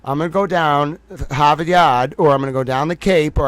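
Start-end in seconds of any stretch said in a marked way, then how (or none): 0.0-2.1 dropped r's, Boston style, lightly played; Boston accent. Shown as a demonstration. Showing the audience his own Boston accent. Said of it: "I have an accent, but I can say". 2.3-3.4 dropped r's, Boston style, lightly played; Boston accent. Shown as a demonstration. Showing the audience his own Boston accent. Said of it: "I have an accent, but I can say".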